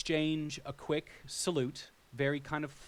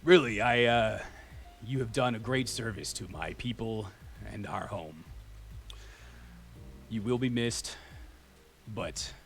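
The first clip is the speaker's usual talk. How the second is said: a hint of a big dragon thanking her; 3 semitones lower than usual. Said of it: "in a deep voice".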